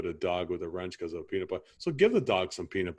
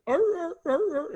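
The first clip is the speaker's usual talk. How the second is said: high-pitched yipping dog yelps, much higher than his own voice; imitated dog yelping; 4 dB louder, slower than usual. Homeowner mimics his injured dog for the technician, high yelps. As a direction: yelp like a hurt beagle. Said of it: "she starts yelping like".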